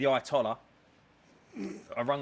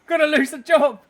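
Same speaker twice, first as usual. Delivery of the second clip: high, loud mock panic; squealing half-joking panic; panicked outburst; 14 semitones higher, 14 dB louder than usual. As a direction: yelp it like the job is slipping away. Retelling panic, voice jumps.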